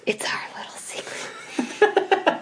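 breathy secretive whisper with a giggle; sly honeyed whisper. Pretend. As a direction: whisper it like a naughty shared secret. Conspiratorial secret about Oreo ice cream.

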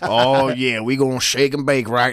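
swaggering cowboy pilot. Retold or imitated. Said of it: "I picture some fucking cowboy up there like".

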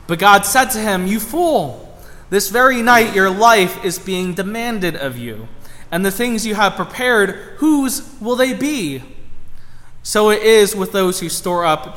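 measured, solemn Bible reading; scripture reading. Acted. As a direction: read the passage with gravity.

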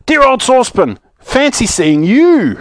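high, delighted, stretched-out hail; yelled greeting. Acted. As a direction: call out to an old friend, high and slowing. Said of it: "he yelled".

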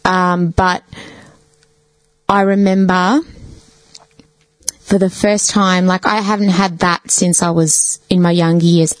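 normal talking voice

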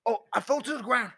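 hammed-up Australian tale; Australian accent. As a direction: spin the yarn like an Aussie bloke. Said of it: "changed to Australian".

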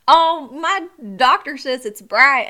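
slow, loud Southern drawl; retelling a stranger's Southern accent; Southern lady. Retold or imitated. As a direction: mimic the old Southern woman. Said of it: "And she goes".

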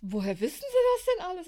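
high, loud amazed question; astonished. Retold or imitated. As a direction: ask in wide-eyed amazement. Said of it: "mit großen Augen angeguckt".

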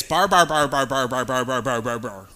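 monotonous run of one syllable, sinking in pitch; barbarian babble. Shown as a demonstration. Preacher demonstrating barbarian speech, droning gibberish that winds down. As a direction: drone like foreign gibberish, fading as it goes. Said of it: "someone who talks like this".

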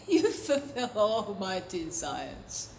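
low, soft, slow sigh of delight; God delighting. Acted. God answers the singing worshipper, softly delighted. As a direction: breathe it out slowly, deeply satisfied. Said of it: "he's there going".

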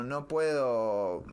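usual speaking voice

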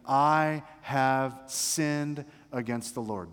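very slow, deliberate admission; sincere confession. Acted. David admitting his guilt to Nathan, sincere confession. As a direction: own it word by word, without tearfulness. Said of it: "There's no crocodile tears here".

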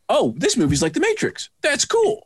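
high, delighted pretend realization; mock viewer enthusiasm. Imagined viewer spotting The Matrix, mock excitement. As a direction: leap up in pitch on the approval. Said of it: "worst thing that was gonna happen".

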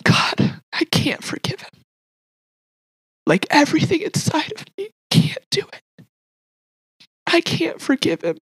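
high, hushed, tearful plea; retold anguished prayer, close to tears; crying out to God. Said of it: "crying out to God".

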